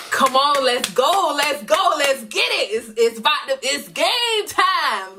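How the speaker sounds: high-pitched pep talk, last words stretched long; hyped game-time vibe. Retold or imitated. Singer rallying her people backstage before the show, hyped. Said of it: "that's the vibe I'm in".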